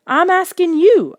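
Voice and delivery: loud, high, slow outburst; crying out. A narrator's reading. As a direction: shout it out in frustration. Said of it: "I cried".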